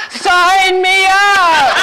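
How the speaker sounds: high, drawn-out eager cry; eager shout. Retold or imitated. Excited man jumping up to sign on, high drawn-out cry. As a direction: blurt it out like a man sold on the spot.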